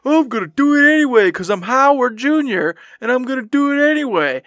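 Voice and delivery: high, loud mocking voice of his own past self; mock stubborn self. Host mocks his past self to his co-host, high and loud. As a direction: ham up a stubborn know-it-all. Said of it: "And here I go".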